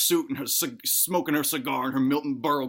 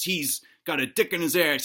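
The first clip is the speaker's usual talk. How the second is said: ordinary delivery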